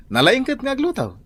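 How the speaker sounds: playful high praise. Acted. Husband teasing praise of cooking.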